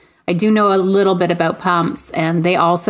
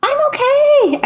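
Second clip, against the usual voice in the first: voice breaking. Real, not acted. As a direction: pitch cracks high on okay. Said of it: "That was actually my voice breaking".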